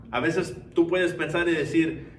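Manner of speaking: ordinary talk, nothing marked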